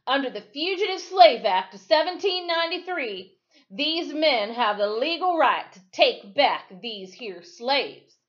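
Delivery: high, loud legal proclamation; shouting deputy. Acted. Deputy shouting the law at the angry crowd, loud. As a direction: bark the law over the mob.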